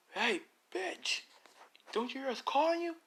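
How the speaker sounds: high mock heckler voice calling out; thug heckling. Thug yelling after the woman, high mocking heckle. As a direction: jeer at her from behind.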